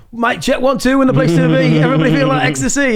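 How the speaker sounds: high-pitched rave MC patter. Retold or imitated. Former MC imitating himself at a local pub, high rave MC. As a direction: hype the crowd like a rave MC on the mic. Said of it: "M C in at raves".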